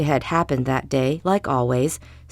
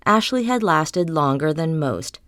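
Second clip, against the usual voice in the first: plain reading voice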